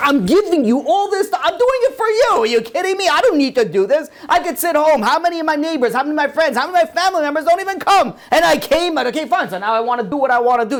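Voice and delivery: high-pitched, rushing protest; mock-indignant rant, piling up grievances; indignant complaint. Acted self-righteous complaint to God.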